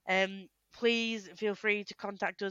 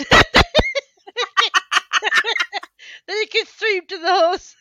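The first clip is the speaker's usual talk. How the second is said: laughing; 11 semitones higher, 14 dB louder, slower than usual. Real, not acted. Laughing through the line.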